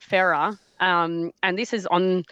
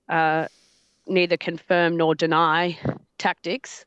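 ordinary delivery

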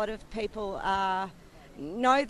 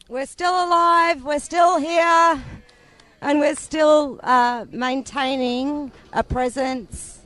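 louder, over a cutting-out speaker; bluetooth speaker; 5 semitones higher, 11 dB louder than usual. Real, not acted. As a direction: repeat it loud through the speaker. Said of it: "our speaker keeps cutting out".

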